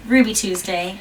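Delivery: usual speaking voice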